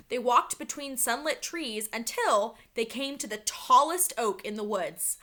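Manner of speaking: plain reading voice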